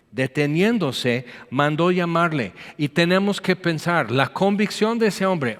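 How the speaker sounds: normal talking voice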